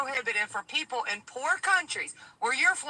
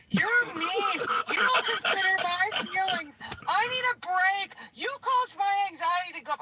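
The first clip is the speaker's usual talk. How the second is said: higher-pitched whiny mock sob, imitating the crying kitchen worker; mock crying complaint; 7 semitones higher than usual. Boss mimics sobbing dishwasher complaining about feelings, whiny mock cry. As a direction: play the crybaby employee, pitched up and wailing. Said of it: "he started crying. He's like".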